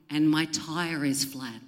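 usual speaking voice

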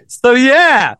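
awkward, laughing and high, loud; awkward, high so yeah. Retold or imitated. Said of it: "I'm just there like".

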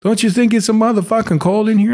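put-on high, lightly Italian-American old man; Italian accent. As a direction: redo the line with the Italian accent. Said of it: "he's got an Italian accent".

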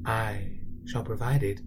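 a menacing storybook villain, slow and whispery at times; a creepy, theatrical villain narrator sliding into hushed whispers; sinister narrator. Acted. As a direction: taunt the listener like a scheming narrator. Villainous narrator taunts the listener.

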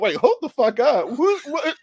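high, loud startled disbelief; shocked outburst. Retold or imitated. Beatrice spots the cyborg mid-kiss, shocked high yelp. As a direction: she can't believe her eyes, yelps it out. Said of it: "and she's like".

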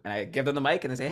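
ordinary talk, nothing marked

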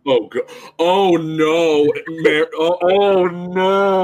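long, drawn-out groan of dread; slow, rising cry of dismay; loud dismay. Real, not acted. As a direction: wail it high and loud in horror. Dismayed reaction to the joke.

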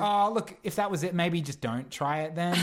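high-pitched retold let-down; mock polite dismissal. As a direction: imitate a casting panel letting someone down. Callback panel dismisses failed accent attempt.

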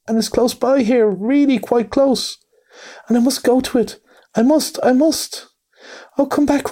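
high, desperate pleading, slowing at the end; beg, the heart breaking; anguished pleading. A narrator's reading. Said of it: "pleaded the poor mole, in anguish of the heart".

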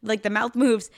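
ordinary delivery

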